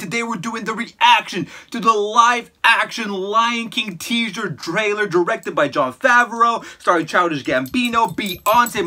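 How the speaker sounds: hyped intro. Real, not acted. Hyped channel intro to viewers.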